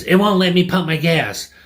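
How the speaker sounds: high, loud indignant complaint; mock angry whine at a clerk; irate customer.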